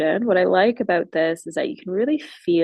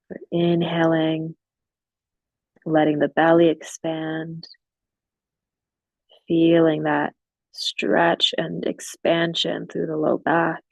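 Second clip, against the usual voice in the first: guided meditation. Real, not acted. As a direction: ease them gradually into stillness, leaving long silences. Leads guided breathing and relaxation.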